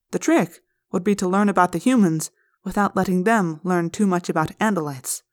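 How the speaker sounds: ordinary delivery, nothing marked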